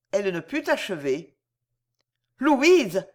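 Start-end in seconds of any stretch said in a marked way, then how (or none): 2.4-3.1 Louise called out loud in alarm; cry out. A narrator's reading. To her friend, crying out Louise in shock. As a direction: call Louise sharply, alarmed. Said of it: "m'écriai-je".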